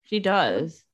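chewing while talking; mouth full. Real, not acted. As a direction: talk around something in your mouth. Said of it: "What are you chewing on".

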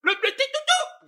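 very high siren noises from the mouth; mock police siren. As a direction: make the cop siren sound. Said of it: "and they like".

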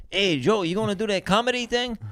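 high, hammed-up accent quote; Alejandro. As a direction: imitate a friend's accent, badly and high. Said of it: "just doing a horrendous accent".

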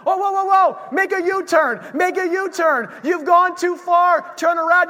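high, loud mock alarm from the navigation voice; sat nav yelling. Pastor plays the navigation voice after a missed turn, hammed-up shout. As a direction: panic the driver into a U-turn. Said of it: "what does he start to do?".

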